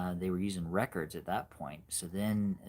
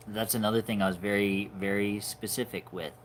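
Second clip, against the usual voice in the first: usual speaking voice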